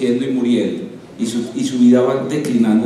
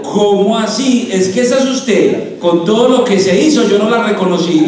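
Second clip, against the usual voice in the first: acted astonishment; 5 semitones higher, 4 dB louder than usual. Saint Peter not recognizing the woman after surgery, mock astonishment. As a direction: play Saint Peter's baffled surprise, stretched out.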